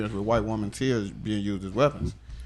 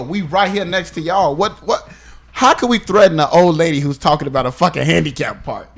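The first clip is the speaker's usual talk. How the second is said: incredulous outrage; 4 semitones higher, 6 dB louder than usual. Real, not acted. Outraged at the police.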